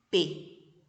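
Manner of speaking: usual speaking voice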